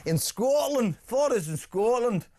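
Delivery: high, rolled-r Scottish twang; Scottish accent. Pretend. Host doing a Scottish accent for a Scottish caller, hammed up. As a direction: put on a hammy Scottish accent. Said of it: "Did you like that impression?".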